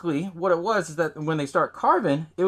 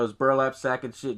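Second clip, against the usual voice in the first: ordinary delivery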